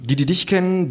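usual speaking voice

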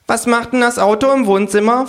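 high, loud creature voice; kangaroo character. A narrator's reading. Kangaroo questioning hostess about car, high and loud. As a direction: ask pointedly in a high animal voice.

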